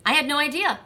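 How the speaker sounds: ordinary talk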